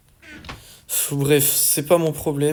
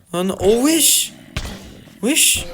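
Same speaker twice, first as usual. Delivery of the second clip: shocked cry; 8 semitones higher, 4 dB louder, slower than usual. Real, not acted. Sees his dinos burning.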